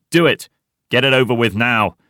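loud, high, urgent egging-on; eager prompting. A narrator's reading. Heidi urges the old man on, eager. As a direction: goad him into it. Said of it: "Heidi prompts him eagerly".

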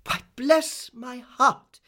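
high, loud, drawn-out amazement; imperious lady taken aback; astonished exclamation. A narrator's reading. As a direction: exclaim it slowly, astounded. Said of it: "exclaimed Miss Betsy".

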